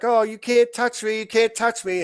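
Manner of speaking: high, taunting sing-song brat voice; mock the smug protester daring the cops. Host mocking protesters who think they're untouchable, in a high taunting voice. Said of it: "Everybody's like".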